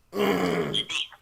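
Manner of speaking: loud real throat clear. Real, not acted. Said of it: "Sorry, guys, I'm kind of losing my voice".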